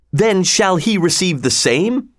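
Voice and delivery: high-pitched indignant storybook cry; narrator's exclaimed challenge. A narrator's reading. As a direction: raise the pitch, keep the volume contained. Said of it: "cried Will Stutely".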